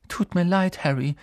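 high-pitched wailing apology; complaining, sorry girl's voice; plaintive. A narrator's reading. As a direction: lament it to a friend. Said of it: "klagte sie".

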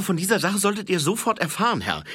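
put-on high, fast, keen young voice; eager high-voiced corporal reporting. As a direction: rattle off the report, high and quick.

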